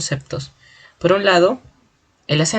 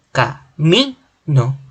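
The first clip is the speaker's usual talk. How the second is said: "Ca-mi-no" very slowly, weight on "mi"; three separate beats in "Ca-mi-no", the middle "mi" strongest; stress on penultimate syllable; much slower than usual. Shown as a demonstration. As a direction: sound out "Ca-mi-no", leaning on "mi". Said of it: "mayor fuerza de voz está en la penúltima sílaba".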